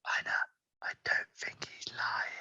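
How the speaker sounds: very soft, drawn-out secret whisper; hushed, voiceless confiding. Acted. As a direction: whisper it slowly into a friend's ear. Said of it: "climbs up on her a little bit to whisper in his ear".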